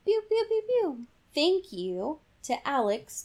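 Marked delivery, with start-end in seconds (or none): 0.0-1.1 high squeaky zaps; high laser pew. Pretend. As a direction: shoot tiny lasers, pitched up. Sound effects.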